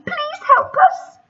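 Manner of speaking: high, slow desperate plea; high pleading. Acted. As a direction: beg for help. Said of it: "cried Sydney and Susie".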